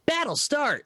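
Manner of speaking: very high video-game announcer call; game sound bite. Pretend. Player imagines a game's battle callout, high announcer voice. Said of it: "sounds like a soundbite".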